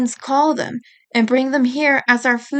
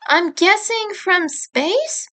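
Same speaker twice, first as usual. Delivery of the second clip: higher, slow and unsure; tentative guess; 7 semitones higher, slower than usual. A narrator's reading. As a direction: offer it hesitantly. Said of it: "Monaco guessed".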